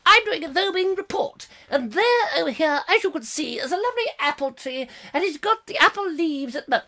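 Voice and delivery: high-pitched, eager field reporter. Retold or imitated. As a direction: play a squeaky roving reporter. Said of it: "there's another one coming in".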